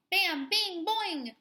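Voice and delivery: plain reading voice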